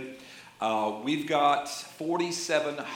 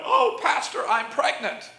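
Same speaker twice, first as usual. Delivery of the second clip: high, louder tearful woman's cry; mock crying out; 11 semitones higher, 4 dB louder than usual. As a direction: imitate a woman crying out in dismay. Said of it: "she cried out".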